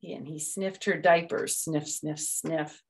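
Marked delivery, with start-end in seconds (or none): 1.5-2.8 soft sniffing. A narrator's reading. Sniffing sound.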